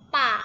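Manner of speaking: pa loud, dipping tone; third tone pa. Shown as a demonstration. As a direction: model pa as third tone. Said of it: "Ada tiga". (pa dengan nada ketiga)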